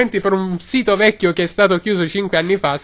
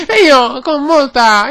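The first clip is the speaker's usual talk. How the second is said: ordinary delivery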